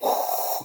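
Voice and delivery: imitated rushing blast of air; voiceless gushing spray sound; power-washer noise. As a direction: blow out a pressure-hose whoosh. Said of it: "power washer pressure, like".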